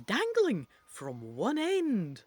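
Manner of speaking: ordinary delivery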